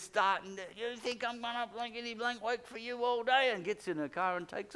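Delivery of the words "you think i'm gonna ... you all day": mock angry, higher pitched outburst; imitating angry friend. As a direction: retell the friend's impatient snap. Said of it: "The friend is getting mad".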